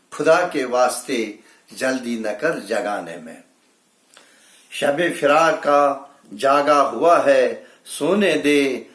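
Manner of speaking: emphatic mushaira declamation; ringing Urdu couplet delivery; poetry recitation. Acted. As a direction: build each repeat of the verse.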